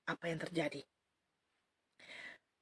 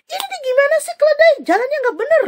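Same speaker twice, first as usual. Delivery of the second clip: much higher, louder angry rant; angry scolding; 8 dB louder than usual. Acted. Bileam berates his donkey, high loud anger. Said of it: "Wah, marah-marah".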